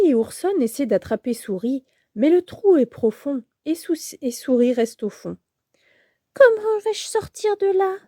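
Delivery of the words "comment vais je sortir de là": higher mouse voice, plaintive; a trapped mouse moaning; lamenting mouse. Acted. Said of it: "se lamente Souris". (souris plaintive, voix aiguë)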